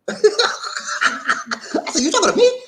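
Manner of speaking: high, loud, incredulous laugh-through; laughing retelling. Retold or imitated. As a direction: crack up while repeating your own comeback. Laughing as he retells his comeback.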